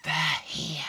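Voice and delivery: urgent feline hiss; hissing cat. Acted. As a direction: lightly hiss it like a watchful cat. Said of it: "hissed the cat".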